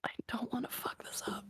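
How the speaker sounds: a tense whisper to herself; nervous whisper. Real, not acted. Player about to roll, a nervous whisper. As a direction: breathe it out, worried about the roll.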